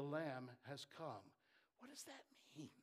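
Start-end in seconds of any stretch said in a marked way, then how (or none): 1.8-2.7 voiceless whisper, leaning in; whispered question. Acted. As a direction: ask it in a hushed whisper. Rhetorical question to congregation.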